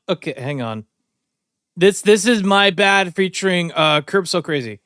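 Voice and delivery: usual speaking voice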